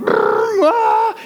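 high-pitched yelp; acted startled scream. Storyteller reliving the slap on a buffalo, acted high scream. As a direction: let out a high, sudden scream. Reenacts reaction to slapping buffalo.

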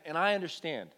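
normal talking voice

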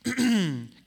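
loud, mock throat clearing for attention; pointed throat clear. [name] clearing her throat at her sister, mock pointed. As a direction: clear your throat pointedly to get noticed. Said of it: "clearing her throat".

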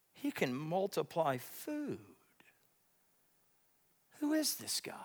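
whispered, slow and hushed; awed whisper. Acted. Preacher wonders at the loaves miracle, awed whisper. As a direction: breathe it out in awe.

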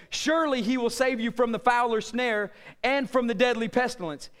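ordinary talk, nothing marked

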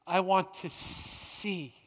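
slow, soft, drawn-out plea; hushed plea. Acted. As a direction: say it slowly and quietly, lingering on each word. Blind man's plea to Jesus.

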